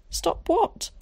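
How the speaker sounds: alarmed. A narrator's reading. Ginny alarmed demanding.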